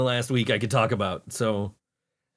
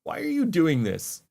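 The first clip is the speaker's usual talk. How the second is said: exasperated; 5 semitones higher, slower than usual. Real, not acted. Exasperated at the band.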